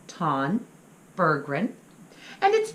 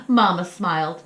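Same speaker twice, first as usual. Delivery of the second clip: plain reading voice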